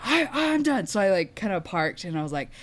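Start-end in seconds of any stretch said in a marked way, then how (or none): 0.1-0.9 exasperated. Retold or imitated.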